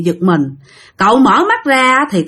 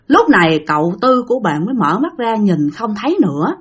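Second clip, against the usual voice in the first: plain reading voice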